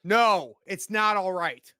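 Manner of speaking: loud, high-pitched snapped refusal; angry shout. Acted. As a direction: yell back in agitation. Acted shouted reply after a shooting.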